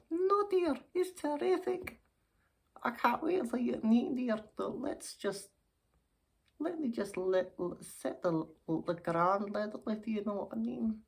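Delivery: put-on earnest old dear starting high, easing down; gush, then explain as an old lady; smitten old lady. Old lady praises suitor.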